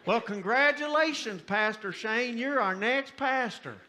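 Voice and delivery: loud, high proclamation; church member. Acted. Church member to the newly elected pastor, loud congratulations. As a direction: proclaim the result to the room. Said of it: "a man walks up and says".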